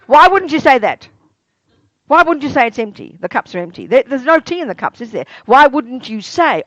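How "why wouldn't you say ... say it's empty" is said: usual speaking voice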